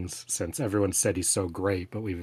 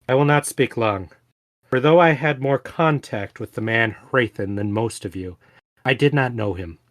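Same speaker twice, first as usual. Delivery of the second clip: formal eulogy reading; 2 semitones higher, 4 dB louder than usual. Acted. Sarene honours Hrathen at his grave, in a formal eulogy. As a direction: deliver a solemn, forceful tribute in measured phrases.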